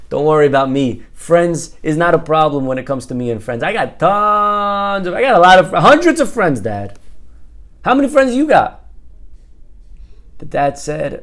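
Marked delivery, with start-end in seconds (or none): 0.1-8.9 loud, brash bragging as the son; cocky boasting. Acted. Son boasts to dad about his friends, cocky. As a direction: brag it up, voice rising on the big numbers.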